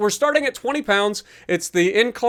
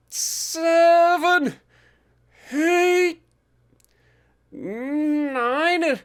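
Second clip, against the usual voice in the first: strained rep count; 8 semitones higher, much slower than usual. Real, not acted. Counting reps under max strain.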